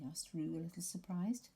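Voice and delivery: ordinary delivery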